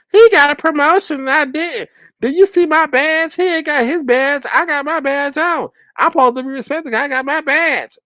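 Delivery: high whiny imitation of him; mock whining. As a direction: whine like a sulky kid about a badge. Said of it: "whining like that".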